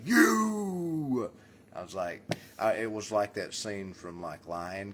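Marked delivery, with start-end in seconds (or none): 0.1-1.3 high loud yell of You; a sudden loud You; shouted You. Retold or imitated. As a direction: belt out You. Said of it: "I was gonna go".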